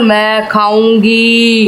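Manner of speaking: low, long drawn-out ghoulish threat; ghost voice demo. Shown as a demonstration. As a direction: stretch it out low like a playful monster. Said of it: "ऐसे बोल".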